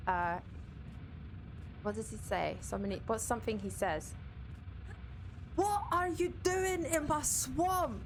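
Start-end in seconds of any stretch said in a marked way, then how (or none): 5.6-8.0 high, slow, drawn-out ogre roar in Scottish; be an aggressive Scottish ogre, stretching every word; Shrek voice. Acted. Streamer doing a requested impression for chat, aggressive Shrek voice. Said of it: "How about Shrek voice?".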